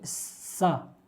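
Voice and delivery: सा drawn out very slowly as a clear palatal sa; palatal sa sound. Shown as a demonstration. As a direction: stretch सा long and slow to show the palatal sound. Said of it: "सा के उच्चारण करती हैं".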